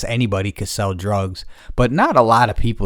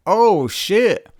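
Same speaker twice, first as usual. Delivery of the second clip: a high, rising oh shit of dismay; high surprised oh shit; 10 semitones higher than usual. Retold or imitated. Telling a friend about the stepkids, high surprised reaction. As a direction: pitch jumps up in shocked disbelief. Realizes the kids thing.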